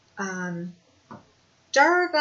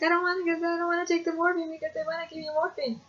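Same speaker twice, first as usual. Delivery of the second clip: higher, rushed anxious pleading; panicked protest; 9 semitones higher, faster than usual. Retold or imitated. Telling her cousin she refuses morphine, rushed and panicky. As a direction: gabble it out in panic.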